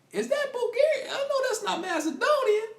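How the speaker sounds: high, loud amazed exclaim; astonished. Retold or imitated. Patient peeking out at the nurses, astonished. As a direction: squeal it in disbelief.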